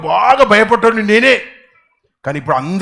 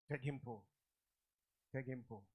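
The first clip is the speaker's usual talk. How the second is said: తెగింపు said softly, lower; quiet repeat; 7 semitones lower, 32 dB quieter than usual. Shown as a demonstration. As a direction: let తెగింపు sink to a soft murmur. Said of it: "తెగింపు, very good". (తెగింపు మెల్లగా)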